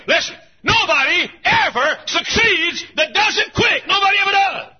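high, loud hellfire preaching; shouting preacher hammering sin; full-force preaching. Acted. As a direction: thunder it from the pulpit.

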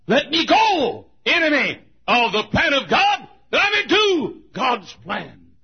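full-voiced preacher's plea; shouted plea. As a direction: preach it at full force, pleading. Preaching climax, shouted prayer.

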